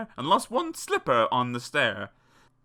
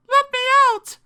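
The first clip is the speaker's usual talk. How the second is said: high-pitched yell to be freed; desperate Cinderella bellow; 10 dB louder than usual. A narrator's reading. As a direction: shriek it loudly at the top of the range. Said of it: "She bellowed".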